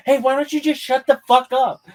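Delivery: high, loud mock yell at a heckler; William Montgomery heckler put-down. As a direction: bark it at the heckler like a furious comic. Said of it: "the way that William Montgomery does it".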